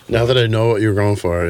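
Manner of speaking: normal talking voice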